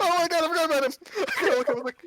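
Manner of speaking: very high, loud, helpless real laughter in the words; laughing outburst. Real, not acted. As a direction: shriek it out while cracking up. Player remembers forgotten creature, cracks up.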